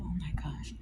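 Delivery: breathless whispered gasp of disbelief; stunned whisper to herself; shocked whisper. Real, not acted. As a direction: whisper it like you can't believe it. Reacting in shock under her breath.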